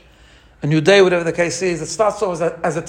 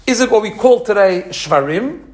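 ordinary talk

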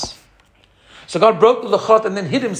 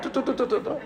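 soft, high tu tu tu through a laugh; laughing truah toots; 6 semitones higher, 9 dB quieter, faster than usual. Shown as a demonstration. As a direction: toot the truah while laughing. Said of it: "That's the truah".

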